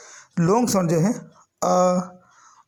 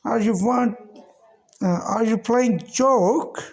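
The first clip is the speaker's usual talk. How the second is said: slow, higher demo questions, partly whispered; demo example sentences; 4 semitones higher, much slower than usual. Shown as a demonstration. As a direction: voice the practice questions up high and slowly. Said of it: "teacher demo example sentences". (ऊँची, धीमी आवाज़ में अभ्यास वाले सवाल)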